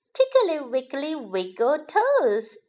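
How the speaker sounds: ordinary delivery, nothing marked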